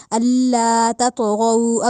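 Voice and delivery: slow chanted Allah تطغوا; tajweed recitation. Shown as a demonstration. Teacher recites the phrase before explaining tta. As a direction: recite slowly in tajweed melody.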